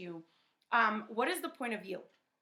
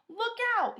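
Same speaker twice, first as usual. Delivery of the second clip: high, loud warning cry; alarmed cry; 14 semitones higher, 7 dB louder than usual. A narrator's reading. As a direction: shout a sudden warning. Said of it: "he cried".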